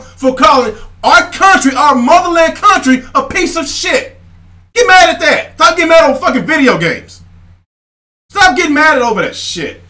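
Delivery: loud, high furious rant; angry rant. Real, not acted. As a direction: yell it, real anger boiling over. Angry rant at fanboys and president.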